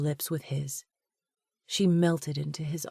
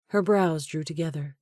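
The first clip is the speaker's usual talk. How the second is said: ordinary delivery, nothing marked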